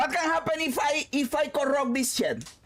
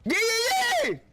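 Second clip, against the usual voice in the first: ordinary talk, nothing marked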